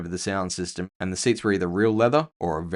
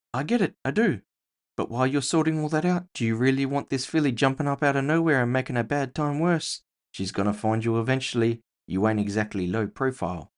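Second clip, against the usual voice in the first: higher, quicker voice for a streetwise orc friend; Jasper's voice; 6 semitones higher than usual. Acted. Jasper presses the narrator about the half-orc, casual and persuasive.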